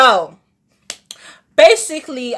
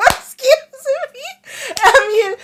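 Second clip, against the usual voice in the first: laughing; 9 semitones higher than usual. Real, not acted. Laughing while admitting surprise.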